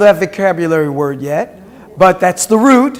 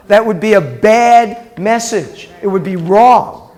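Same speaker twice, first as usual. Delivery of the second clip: ordinary talk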